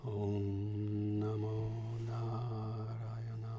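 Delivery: slow soft mantra whispered close; whispering into ear. Real, not acted. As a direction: breathe the mantra slowly into his ear. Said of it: "I'm going to whisper into your right ear".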